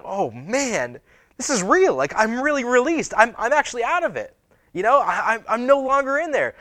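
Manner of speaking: high, loud disbelieving relief, acted out; amazed realization. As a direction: act out dawning amazement, voice leaping up. Acts Peter realizing he is free.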